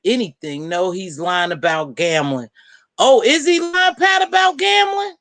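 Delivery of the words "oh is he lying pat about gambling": mock surprise. Real, not acted. Asking Pat about the lying man, high mock surprise. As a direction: feign shock at the news.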